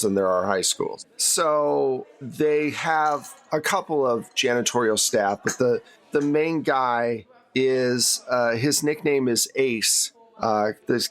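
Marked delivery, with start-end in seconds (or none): none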